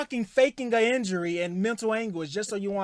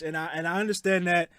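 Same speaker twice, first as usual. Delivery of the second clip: ordinary delivery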